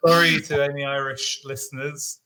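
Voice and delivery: usual speaking voice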